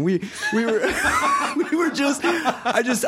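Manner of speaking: high voice breaking into laughter; laughing. Retold or imitated. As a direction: giggle through the words, can't hold the gag. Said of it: "He goes".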